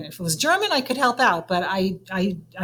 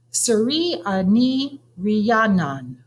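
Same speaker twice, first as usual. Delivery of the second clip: slow, careful syllable by syllable; very slow, deliberate reading of a name; said slowly; 3 semitones higher, much slower than usual. Acted. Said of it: "I say slowly".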